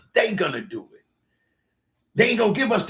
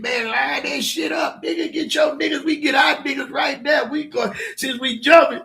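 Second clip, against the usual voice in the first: retold hype call; 10 semitones higher, 4 dB louder than usual. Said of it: "Kiki said".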